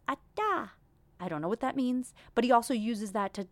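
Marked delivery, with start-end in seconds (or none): 0.1-0.7 put-on much higher baby babble; lightly voiced toddler word; imitating toddler. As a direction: chirp it like a baby. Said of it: "he says".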